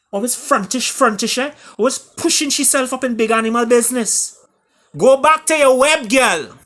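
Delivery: high, loud mocking taunts in Caribbean voice; jeering chorus. A narrator's reading. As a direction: jeer at her loudly and high like a rowdy crowd. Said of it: "chorus chimed in with some choice words".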